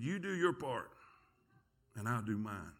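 soft, low voice of God; quiet divine assurance. Retold or imitated. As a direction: state the promise gently and gravely. God answers the ashamed preacher.

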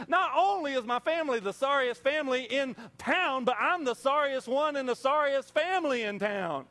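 self-pitying whine. Retold or imitated. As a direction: wallow in self-pity. Gideon complaining about himself.

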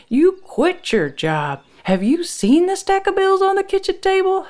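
high, heated scolding, climbing at the end; angry accusation. A narrator's reading. Wife accusing Frank over job and bills, angry. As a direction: lay into your husband.

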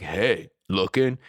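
slow, drawn-out pickup line; mock come-on. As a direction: lure them in slowly. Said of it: "And they're like".

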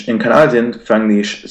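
ordinary talk, nothing marked

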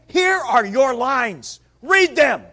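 loud, high, slow dictating; bossy command. Retold or imitated. Peter ordering Jesus around, loud and high. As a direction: hand over the script, loud and bossy.

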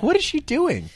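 squeaky disbelieving question; high mock disbelief. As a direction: raise the voice high, baffled. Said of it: "I'm like".

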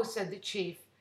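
plain reading voice

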